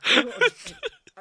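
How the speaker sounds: high, loud, slow drawn-out cleric; hammed-up cleric voice; slow high character voice. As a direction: stretch it out in a high voice.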